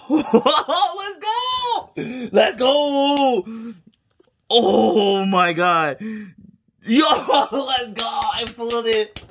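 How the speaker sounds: laughing, shouting let's go; triumphant high cheering with laughs; shrieking jackpot winner. Real, not acted. Celebrates the pull.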